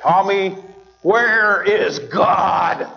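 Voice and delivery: a stern preacher calling out the question. Retold or imitated. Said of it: "as he raised his voice even louder".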